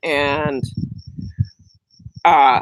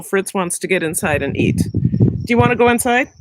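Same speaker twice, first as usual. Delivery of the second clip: usual speaking voice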